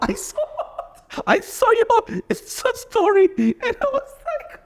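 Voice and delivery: high voice breaking into laughter; can barely talk for laughing; laughing hard. Real, not acted. Laughing at friend's Insta story.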